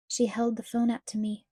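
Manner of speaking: usual speaking voice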